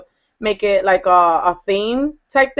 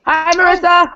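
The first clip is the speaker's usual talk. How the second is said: excited greeting; 9 semitones higher, 5 dB louder than usual. Real, not acted.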